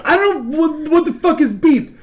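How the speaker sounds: high, stumbling mock protest; flustered bafflement. Coworker quizzed about beef, retold flustered protest. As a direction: imitate someone baffled by a quiz.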